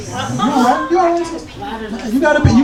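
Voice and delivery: high, slow "You all right?"; high concerned. Real, not acted. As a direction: ask gently but high. Checking on audience member.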